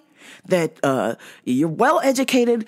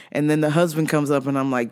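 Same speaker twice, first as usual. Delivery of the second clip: ordinary talk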